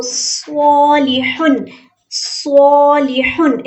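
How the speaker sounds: सॉलिहुन with the first vowel held one alif long; slow recitation. Shown as a demonstration. Teacher models correct madda, slow and deliberate. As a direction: recite सॉलिहुन slowly, stretching only the alif. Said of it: "ज़बर के बाद अलीफ है".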